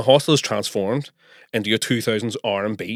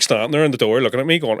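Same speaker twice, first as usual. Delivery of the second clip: normal talking voice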